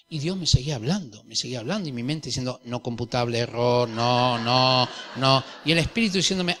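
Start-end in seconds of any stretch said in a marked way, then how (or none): 2.7-5.4 computer error. Retold or imitated. Mind as malfunctioning computer.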